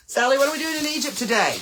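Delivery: high, loud, bright hail to a friend; excited call-out. Real, not acted. Calling out to viewer Sally in Egypt, excited high hail. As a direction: call her name out across the room.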